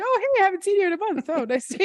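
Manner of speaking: high, fast, bubbly hello; cheery mock greeting. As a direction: gush at a familiar face like an old friend. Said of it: "And I'm like".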